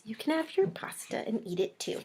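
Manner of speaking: secret-sharing whisper. Acted. Whispering a secret to viewers. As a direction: lean in and whisper it.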